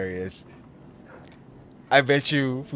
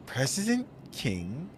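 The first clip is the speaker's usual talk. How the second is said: slow mock repeat; 3 semitones higher, 6 dB quieter, slower than usual.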